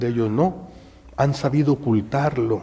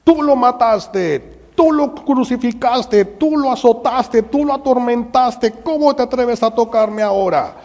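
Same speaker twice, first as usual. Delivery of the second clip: high, loud, accusing cry with each tú hammered; Magdalene screaming; 10 semitones higher, 4 dB louder than usual. Acted. Mary Magdalene screaming at the Roman soldier, furious. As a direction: scream it at the soldier in grief and rage. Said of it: "Y ella grita".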